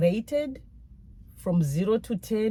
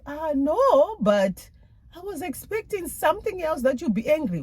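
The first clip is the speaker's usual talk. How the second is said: high, pressing protest; exasperated; 10 semitones higher than usual. Retold or imitated. Wife arguing with husband about punishment, exasperated and high. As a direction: push back, higher and faster. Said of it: "I'm like".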